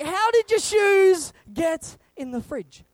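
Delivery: slow, high incredulous question; baffled dad. Retold or imitated. Dad asking how the shoes got in the fridge, slowly. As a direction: ask it in disbelief.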